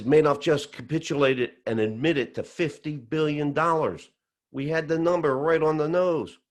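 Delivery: high, excited mock yell; retold screaming. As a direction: imitate a friend shouting big news down the phone.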